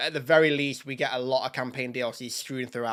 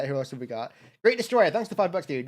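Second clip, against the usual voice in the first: ordinary talk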